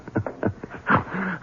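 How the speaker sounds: put-on high, soft drunken chuckle; bitter drunk laugh. Drunk husband laughing at his luck, soft.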